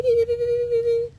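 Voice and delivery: high, loud mimicked beeping; imitated radar beeps. Imitating the radar detector beeping, high and loud. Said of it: "with their beep".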